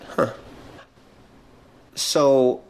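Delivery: usual speaking voice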